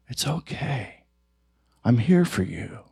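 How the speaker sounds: softly reassuring a frightened part; tender. Shown as a demonstration. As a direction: soothe it like a crying baby. Soothing the hurting parts.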